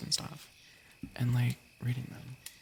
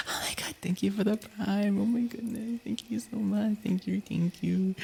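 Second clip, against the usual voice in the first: much higher, gushing thank-yous; squealing thanks; 8 semitones higher than usual. Real, not acted. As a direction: squeal your thanks to chat. Thanking a chatter for prime.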